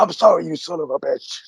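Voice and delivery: a hint of a sneering boss, spat out; mock Vince sneer. Boss sneering his forced apology at a wrestler, lightly voiced. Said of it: "He's like".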